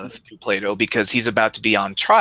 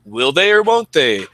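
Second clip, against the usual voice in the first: higher, louder, slowed-down parody of a sitcom pitch; mock sitcom hype; 6 semitones higher, 5 dB louder than usual. Mocking standard sitcoms, cheesy announcer-like sitcom hype. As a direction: ham up the cheesy sitcom premise.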